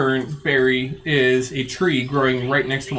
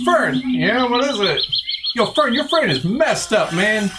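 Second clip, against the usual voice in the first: raised, high calling out; high bro voice calling; 6 semitones higher than usual. Acted. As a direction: call out high and bright. Barry calls out to the fern.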